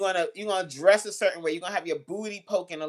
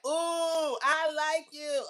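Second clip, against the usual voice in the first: higher, slow forward come-on; mock obvious flirt; 8 semitones higher, much slower than usual. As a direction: overdo the flirt on purpose. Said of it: "We don't be like".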